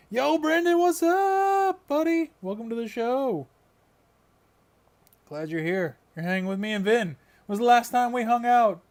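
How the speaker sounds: over-the-top stream host welcoming a friend; hyped welcome. Pretend. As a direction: greet your buddy like a cartoon host, way up high. Hammed-up welcome for a guest.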